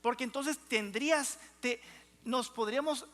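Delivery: ordinary talk, nothing marked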